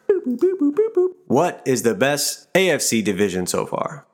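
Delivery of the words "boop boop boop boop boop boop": high rising robot boops; toy robot voice. Pretend. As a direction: chirp like a toy robot thinking. Robot computing the answer.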